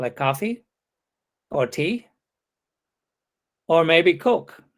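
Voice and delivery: "coffee" and "tea" each rising, with pauses; open list intonation. Shown as a demonstration. Lecturer showing a third alternative, rising open-list intonation. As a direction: keep "tea" rising, then add "Coke" as an afterthought. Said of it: "might actually even be a third alternative".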